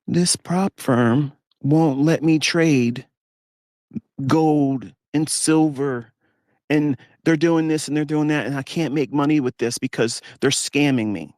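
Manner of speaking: high, slow mock complaint; mock whining. Imitating traders complaining about prop firms, high mock whine. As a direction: whine the grievance like a sulking trader. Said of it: "crying and complaining about".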